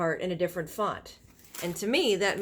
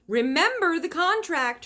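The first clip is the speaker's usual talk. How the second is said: high, loud warning call; mother reminding her daughter from downstairs; high, loud shouted reminder; 9 semitones higher, 6 dB louder than usual. A narrator's reading. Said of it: "her mother shouted".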